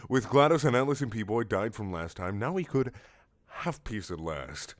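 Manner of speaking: ordinary delivery, nothing marked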